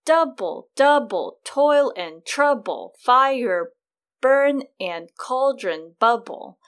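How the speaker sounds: loud, slow beat hitting the first syllable of "Double", "trouble", "bubble"; trochaic stress. Shown as a demonstration. Host shows trochees with the witches' lines, loud first-syllable stress on "Double". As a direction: pound out "Double, double" with falling feet. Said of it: "an example of that would be these lines".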